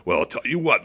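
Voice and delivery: ordinary delivery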